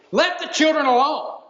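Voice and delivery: firm, annoyed order to back off; indignant rebuke. Shown as a demonstration. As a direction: snap it like an irritated teacher. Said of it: "could very well be the tone that's used".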